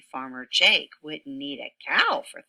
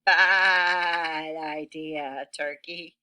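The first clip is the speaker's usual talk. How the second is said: very slow, drawn-out warning; slow rooster; 4 dB louder, slower than usual. Acted. Rooster warning Turkey, very slow drawn-out voice. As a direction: stretch every word as a knowing rooster.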